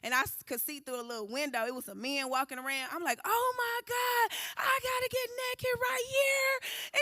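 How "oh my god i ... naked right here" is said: high, slow, crying panic; freaking out. Retold or imitated. Told to strip in the jail, crying in panic. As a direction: wail it in horrified disbelief. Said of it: "I was freaking out".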